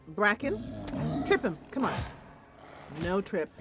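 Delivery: ordinary talk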